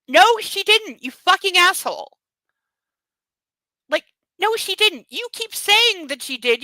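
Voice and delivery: high, loud, furious ranting at him; outraged rant. Real, not acted. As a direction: tear into the character, fully fed up. Outraged rant at book character.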